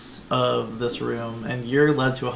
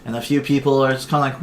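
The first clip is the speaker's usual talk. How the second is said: ordinary talk, nothing marked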